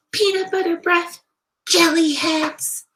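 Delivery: high, loud name-calling; jeering kids' taunt. A narrator's reading. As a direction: jeer it across the lunchroom. Kids' taunts across lunch tables.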